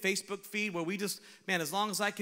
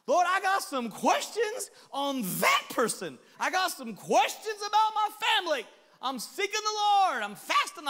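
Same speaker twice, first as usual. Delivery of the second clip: loud, high plea to God; mock complaining prayer; 9 semitones higher, 6 dB louder than usual. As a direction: pile up grievances, pitched high and insistent.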